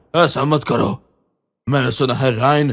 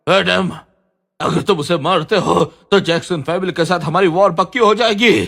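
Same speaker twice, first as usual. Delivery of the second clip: loud frantic warning; 5 semitones higher, 4 dB louder than usual. Acted. Frantic warning to stop killing.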